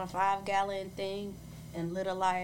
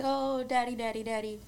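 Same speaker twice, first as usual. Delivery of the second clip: lightly voiced child, higher pitched; excited kids greeting dad; 5 semitones higher, 3 dB louder than usual. Kids running up to their dad on a visit, lightly imitated excitement. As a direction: call out to daddy like a little kid, lightly.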